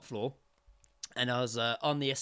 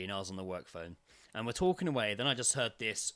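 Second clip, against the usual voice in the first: ordinary talk, nothing marked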